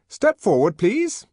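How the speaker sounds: high, loud orders, slowing at the end; peremptory. A narrator's reading. Young man ordering the twins forward, peremptory. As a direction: command the crowd to line up. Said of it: "he said peremptorily".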